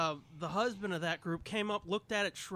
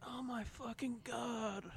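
exasperated; 5 semitones higher, 6 dB quieter, slower than usual. Retold or imitated. Exasperated retold reaction.

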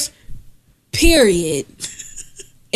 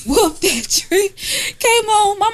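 laughing; 9 semitones higher, slower than usual. Real, not acted. Laughing while recalling the scene.

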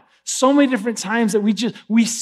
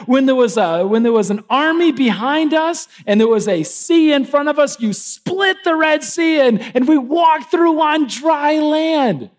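higher, stretched-out recounting to God; fervent acted prayer; 7 semitones higher than usual. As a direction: build in pitch and draw out the big words. Acting the people's fervent prayer to God.

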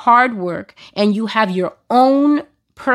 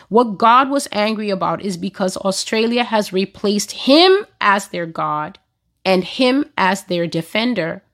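ordinary talk

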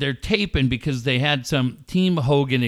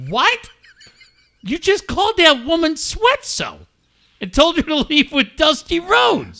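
very high mock disbelief; incredulous interviewer; 13 semitones higher than usual. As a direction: imitate a stunned interviewer in a high voice. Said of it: "guy's sitting there holding the microphone".

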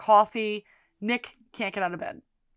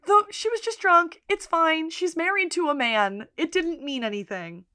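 lightly voiced Debbie shrugging it off; mock reassuring Debbie; 7 semitones higher than usual. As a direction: brush it off brightly, higher.

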